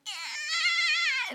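high, wailing cry on the yeah. Retold or imitated. Answering her dad on the phone, tearful wail.

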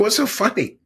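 high, teary half-laugh question; laughing through tears. Retold or imitated. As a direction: ask it while laughing and crying. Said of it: "I'm laughing through my tears".

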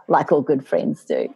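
ordinary delivery

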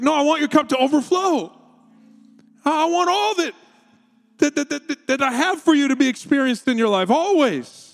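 high, earnest insistence; pleading God voice. Retold or imitated. God answering his children, high eager insistence. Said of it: "He's like".

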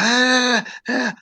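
high panicked yelps; panicked cries. Retold or imitated. In the back seat, panicked yelps. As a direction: yelp in distress. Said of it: "And I'm like".

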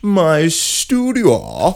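high, very slow stretched echo; high drawn-out echo. Acted.

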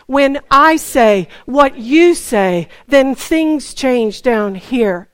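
loud, slow, measured proclamation; emphatic group declaration. Shown as a demonstration. Preacher leading the congregation to say it together, loud and deliberate. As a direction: declare it slowly, punching each phrase. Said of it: "say that out loud together".

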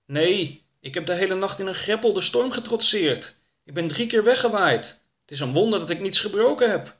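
high-pitched, protesting character voice; affronted and high; Oroka. Acted. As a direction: argue back in a high, animated voice.

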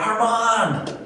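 a father's lightly acted shout for his son; retold scream. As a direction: call out the son's name as if across the rubble. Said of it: "And he screamed his name".

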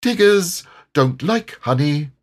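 decided storybook character voice. A narrator's reading. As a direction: pronounce it with total certainty. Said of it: "in a very decided voice".

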